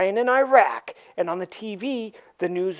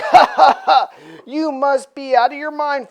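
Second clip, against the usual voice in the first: laughing, louder and higher; mocking laugh; 6 semitones higher, 8 dB louder than usual. The team laughing at Spider-Man's offer. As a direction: laugh him off. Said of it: "everybody's laughing at Spider-Man".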